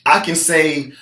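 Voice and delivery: normal talking voice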